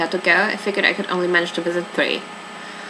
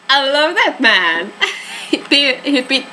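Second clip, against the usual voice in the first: slow and higher, laughing through the words; 5 semitones higher, slower than usual. Real, not acted. Player mocking a suspect's devotion, laughing. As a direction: laugh while saying it in disbelief.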